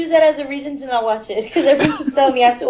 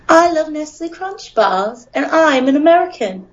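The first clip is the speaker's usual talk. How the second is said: measured British voice, carefully enunciated; British accent; 3 semitones higher than usual. Shown as a demonstration. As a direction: deliver it as a polished British impression. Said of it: "Very well done".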